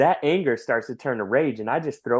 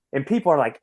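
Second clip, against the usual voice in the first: usual speaking voice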